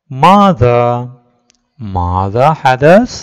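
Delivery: put-on loud, very slow, drawn-out reading for children; teacher reading aloud. Shown as a demonstration. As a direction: stretch every word so the class can follow. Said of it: "sir വായിക്കാം".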